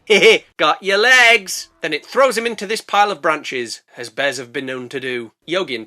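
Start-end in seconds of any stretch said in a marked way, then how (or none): 0.1-1.7 loud, slow teasing bear voice; gloating bear. Acted. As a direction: taunt your prey. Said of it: "is like".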